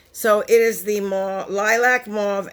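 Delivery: ordinary talk, nothing marked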